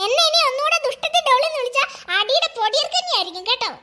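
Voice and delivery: put-on a stuck-up doll brushing the girl off; haughty doll. Doll haughtily refusing to play with the girl.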